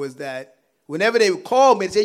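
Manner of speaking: ordinary talk, nothing marked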